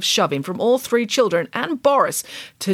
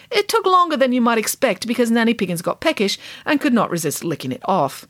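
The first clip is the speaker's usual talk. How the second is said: plain reading voice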